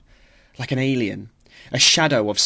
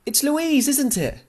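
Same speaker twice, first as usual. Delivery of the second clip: high delighted gush, much higher; excited recognition; 14 semitones higher than usual. A narrator's reading. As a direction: greet a long-lost sister. Stranger excitedly recognises her.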